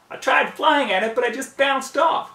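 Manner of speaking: a tomboy flyer complaining in alarm; high loud whiny plea; whimpering pegasus. A narrator's reading. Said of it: "whimpered the annoying blue Pegasus".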